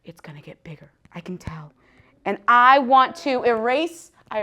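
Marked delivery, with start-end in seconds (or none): 0.0-1.7 secretive unvoiced whisper. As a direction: confide it in a hush. Whispering to husband in bed.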